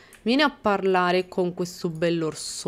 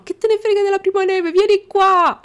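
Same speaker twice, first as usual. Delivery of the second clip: high, fast, loud scolding; exasperated yell; 12 semitones higher, 8 dB louder than usual. Real, not acted. Gamer yelling at a toddler in the snow, exasperated and loud.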